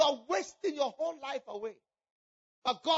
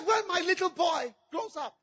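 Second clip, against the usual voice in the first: loud, high, wailing despair; a mother shouting her despair through tears, higher and louder; anguished crying shout; 8 semitones higher, 11 dB louder, slower than usual. Acted. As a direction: cry it out, heartbroken and loud. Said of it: "She cried".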